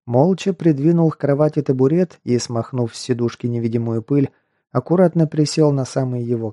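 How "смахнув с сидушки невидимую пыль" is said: ordinary delivery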